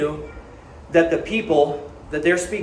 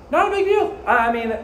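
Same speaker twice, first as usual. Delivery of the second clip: mocking dismissal; 8 semitones higher, faster than usual. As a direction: sneer out his excuse in a high put-on voice. Mocking the smug alcoholic's dismissal.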